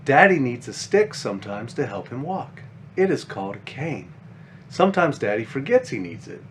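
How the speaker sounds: put-on gentle, lively storybook read for kids; reading to children. A narrator's reading. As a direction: read it like a bedtime picture book. Reads children's book aloud.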